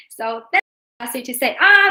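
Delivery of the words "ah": "ah" held high and level; high flat tone. Shown as a demonstration. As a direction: sing "ah" on one high pitch. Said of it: "It's very high and flat".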